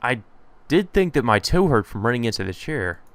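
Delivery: ordinary talk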